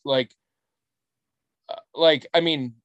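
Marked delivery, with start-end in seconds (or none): none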